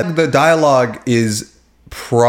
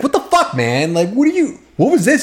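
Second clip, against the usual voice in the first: mock outraged Coop; 11 semitones higher than usual. Coop confronting Devin, hammed-up high indignant voice. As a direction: play up Coop's disbelief in a raised voice. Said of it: "he comes up to Devin and he's like".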